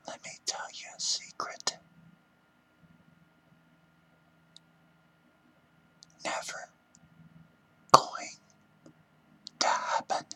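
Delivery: hushed whisper, word by word at the end; secretive whisper. Pretend. Speaker confides a secret to listeners, whispering.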